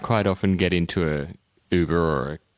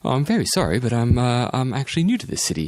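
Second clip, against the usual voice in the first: higher-pitched put-on British; pretend British accent; 6 semitones higher than usual. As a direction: play an apologetic British newcomer. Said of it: "I'll pretend that I'm from Britain".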